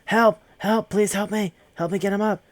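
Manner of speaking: man calling for help to lift the hanging man, frantic shout; frantic cry for help. Retold or imitated. Panicked yelling for help. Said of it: "he goes".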